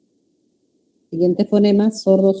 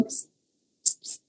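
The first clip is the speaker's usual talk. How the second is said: repeated short hissed "ps"; annoying psst; 18 dB quieter than usual. Shown as a demonstration. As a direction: hiss "ps, ps" the way people do to pester someone. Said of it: "pero dice".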